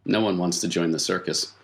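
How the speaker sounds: ordinary talk, nothing marked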